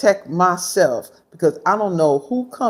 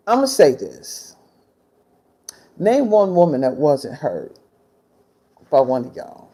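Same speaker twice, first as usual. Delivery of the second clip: ordinary delivery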